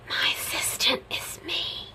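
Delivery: unvoiced confiding whisper; whispered joke. Real, not acted. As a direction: let the punchline slip out in a whisper. Joking aside about her assistant.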